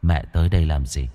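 put-on low, soft ghost-child voice; ghostly son. A narrator's reading. Dead son greeting his mother, low and hushed. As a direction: speak low and hushed like a returning spirit.

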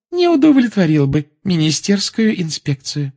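high, emphatic teacher's lecture; officious Umbridge. A narrator's reading. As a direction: lecture the class in a high, stretched voice. (высокий, назидательный голос чиновной преподавательницы)

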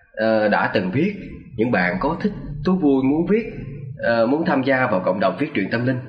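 usual speaking voice

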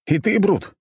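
outraged mock-dramatic exclamation; indignant. A narrator's reading. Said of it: "вознегодовал я".

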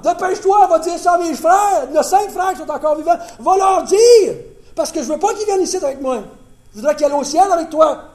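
high, loud urgent begging shout; desperate pleading. Acted. Dead rich man urging Lazare to warn his brothers, loud and high. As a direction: beg him to hurry before it's too late.

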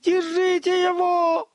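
high, loud, drawn-out shout; slow high-pitched holler of alarm; cook's yell. A narrator's reading. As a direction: yell to have someone caught. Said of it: "заорал мастер-повар".

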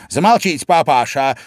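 high, loud insolent youngster; cheeky retort. A narrator's reading. Young crab answers his father, cheekily. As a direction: talk back loud and high. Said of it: "дерзко ответил юный краб".

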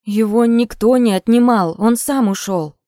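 loud, defensive justification. A narrator's reading. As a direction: defend yourself firmly. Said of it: "оправдывалась Полина Анатольевна".

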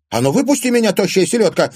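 very high, loud outraged demand; indignant. A narrator's reading. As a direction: yell through a locked door in outrage. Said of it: "возмутилась". (возмущённо, на крике)

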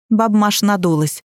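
plain reading voice

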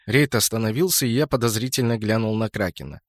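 plain reading voice

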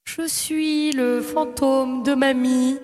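slow, drawn-out ghostly grandma; haunt the line slowly like a ghost. Acted. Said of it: "la voix de fantôme".

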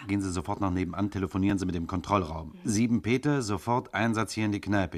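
fast, clipped commands; rapid orders. Acted. Commissioner rushing orders at his assistant, fast. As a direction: rattle the orders off.

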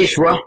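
رشوة with the first vowel a short "i"; kasra on the ra. Shown as a demonstration. Teacher shows students a valid variant, رشوة said "rishwa". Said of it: "وكسرها".

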